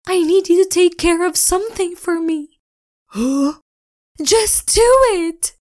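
urgent high Spirit. Acted.

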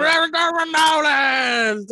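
high, slow hyped shout; mock hype shout. S0 imitating a New Orleans comic on stage, slow hype shout. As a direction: yell it like a party cheer, slow. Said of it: "they're like".

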